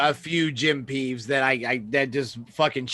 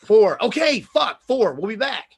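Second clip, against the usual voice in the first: higher, louder sarcastic "okay, fuck"; mock exasperation; 6 semitones higher, 6 dB louder, slower than usual. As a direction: throw the number back at him in disbelief. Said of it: "It's like".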